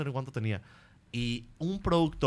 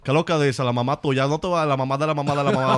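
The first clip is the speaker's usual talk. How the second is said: loud, rattling Dominican accent; caricatured Dominican patter; 2 semitones higher, 6 dB louder, faster than usual. Shown as a demonstration. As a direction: rattle off Dominican street talk.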